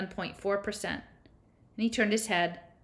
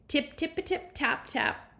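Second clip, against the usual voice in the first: playful singsong; 8 semitones higher, slower than usual. Pretend. Patting hips to amuse Violet.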